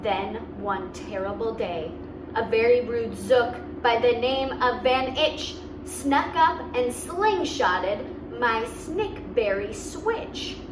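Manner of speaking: usual speaking voice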